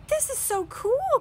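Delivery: put-on very high, loud girlish awe; breathless with wonder. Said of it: "Chrissy said in awe".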